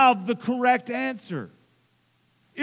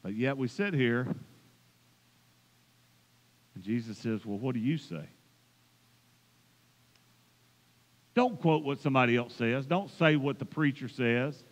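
much softer, lower pastoral voice; intimate hush; 9 semitones lower, 9 dB quieter than usual. Acted. Pastor asking each listener personally, hushed and soft. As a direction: lean in and speak softly to each listener. Preacher drops to intimate challenge.

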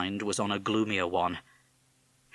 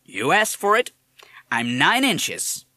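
put-on higher, louder irritated American accent; nettled American parrot; 8 semitones higher, 8 dB louder, slower than usual. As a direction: snap back like an annoyed wise guy. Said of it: "nettled by the policeman's tone".

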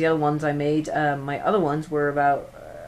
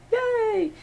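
tiny, very high-pitched yay; high squeaky cheer. Pretend. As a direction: squeal a little celebration. Mock cheer after announcing fan.

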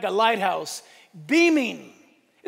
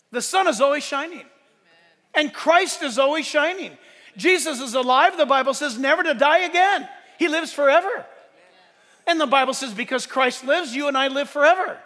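raised, ringing preacher's voice; preaching at full force; 3 semitones higher, 4 dB louder than usual. Acted. Preaching builds again.

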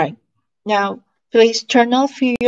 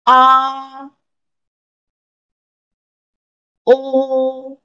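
high, held vowels for repetition; teacher drilling sounds; 4 semitones higher, much slower than usual. Shown as a demonstration. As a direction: model each vowel slowly and clearly. Reads finals for repetition.

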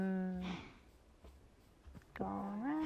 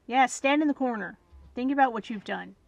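mock scolding; 8 dB louder than usual. Real, not acted.

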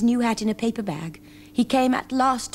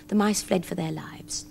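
usual speaking voice

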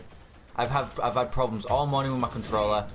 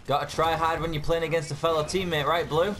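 higher, mocking taunt at a teammate; raised sarcastic gamer taunt; sarcastic jab; 6 semitones higher than usual. Real, not acted. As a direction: needle Blue with sarcasm.

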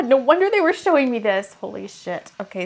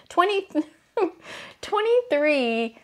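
ordinary talk

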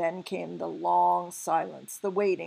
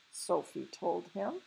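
usual speaking voice

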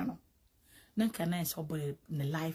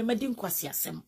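ordinary delivery

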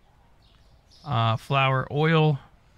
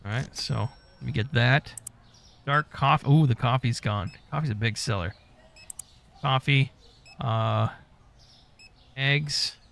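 ordinary talk, nothing marked

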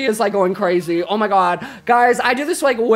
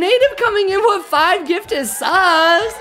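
high, slow, thrilled cry for a donor; excited squeal; 9 semitones higher, much slower than usual. Real, not acted. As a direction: shriek the name with joy. Streamer thanks a gifted sub.

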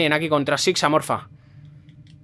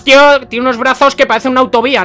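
high, loud gamer complaint; raised-voice exasperation; exasperated outburst; 6 semitones higher, 7 dB louder than usual. Real, not acted. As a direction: protest loudly at an unfair enemy. Complains about enemy's long reach.

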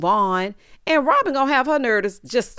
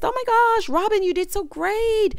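higher gushing squeal, hammed-up; mock gushing praise; 10 semitones higher than usual. Mocking cast praising Robin's villa, high gushing squeal. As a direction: parody their over-the-top excitement.

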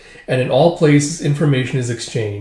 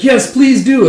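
high, loud eager reply; calling back; 11 semitones higher, 7 dB louder, slower than usual. Acted. Answering while emptying the storehouse, calling back. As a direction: call it back brightly while busy.

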